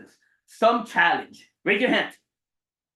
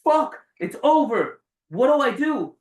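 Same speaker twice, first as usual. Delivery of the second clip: panicked outburst; 4 semitones higher, 6 dB louder than usual. Retold or imitated. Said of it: "I could have sat there and said".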